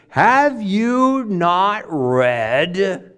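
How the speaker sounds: very slow, drawn-out mocking question; sarcastic. Jesus repeating his jab at the leaders, sarcastic. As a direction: stretch each word to tease. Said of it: "a sense of some sarcasm in Jesus".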